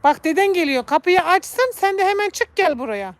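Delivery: high, loud urging calls; coaxing the cat. Real, not acted. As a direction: coax the cat out of the coal shed. (kediyi dışarı çağırırken yüksek sesle)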